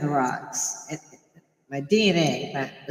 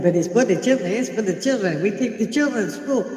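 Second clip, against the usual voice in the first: raised, higher mocking voice; hammed-up mock protester, higher; mock yelling protesters; 8 semitones higher than usual. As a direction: lampoon them, voice pitched up. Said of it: "And they're yelling".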